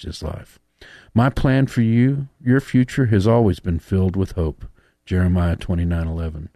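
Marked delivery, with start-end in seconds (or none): 1.1-4.7 reading a love letter. Acted. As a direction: read it like a heartfelt letter. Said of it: "I'm reading a love letter from God".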